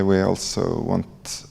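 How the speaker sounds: normal talking voice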